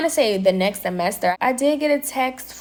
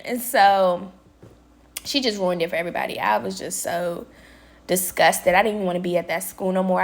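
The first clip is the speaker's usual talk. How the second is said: ordinary talk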